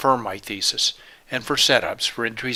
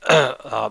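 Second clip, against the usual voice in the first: clears throat; 4 dB louder than usual. Real, not acted. Clearing voice mid-sentence.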